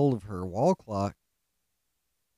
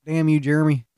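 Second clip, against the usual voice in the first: louder exasperated scold; mock angry outburst; 3 semitones higher, 7 dB louder than usual. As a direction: bark his name like a fed-up coworker. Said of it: "she's like".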